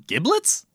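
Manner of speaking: Giblets? rising up high like a question. Shown as a demonstration. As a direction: say Giblets? with a big upward lift. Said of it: "With a question mark?".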